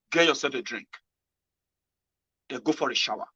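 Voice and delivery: ordinary talk, nothing marked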